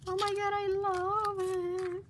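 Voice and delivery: high, giggly gasp of delight; delighted squeal. Real, not acted. Vlogger thrilled by a friend's gift, high excited squeal.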